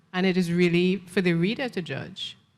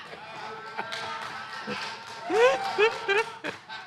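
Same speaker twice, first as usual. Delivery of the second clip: airy laugh; 5 dB quieter than usual. Real, not acted. Reaction to the compliment.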